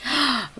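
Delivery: quick startled intake of breath; little storytime gasp; sharp gasp. Real, not acted. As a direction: gasp in surprise at the page. Gasp at the picture.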